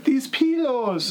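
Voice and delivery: high, slow, stretched-out mimicry; imitating the actress. Co-host quoting the actress's character, high drawn-out imitation. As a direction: draw it out high like the movie character. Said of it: "She's the chick that's like".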